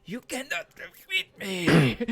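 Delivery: high, slow, loud villain proclamation; booming echoing villain monologue. Acted. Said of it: "he brings up his hands and goes".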